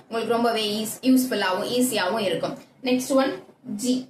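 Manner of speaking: ordinary delivery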